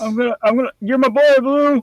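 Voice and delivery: high, loud excited cry; excited shout. Retold or imitated. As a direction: call out to your buddy, thrilled.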